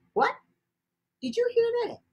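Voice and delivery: high, surprised squeak; startled question. Acted. Girl hearing a stranger sing, startled high question.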